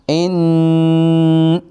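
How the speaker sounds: nasal hum held one alif; ghunna demo. Shown as a demonstration. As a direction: hum the nun through the nose, stretched. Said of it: "ایک الف جتنا".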